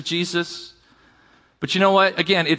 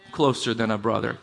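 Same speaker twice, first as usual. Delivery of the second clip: dropped low and soft; softer repeat; 8 semitones lower, 6 dB quieter than usual. Shown as a demonstration. Pastor echoing the verse to the church, hushed and low. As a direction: let the phrase sink in quietly.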